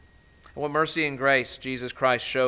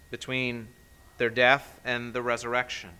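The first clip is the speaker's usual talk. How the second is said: ordinary talk, nothing marked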